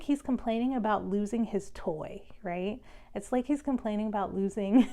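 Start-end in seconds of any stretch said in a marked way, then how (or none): 4.9-4.9 laughing. Real, not acted. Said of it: "I'm like, laughing the whole time".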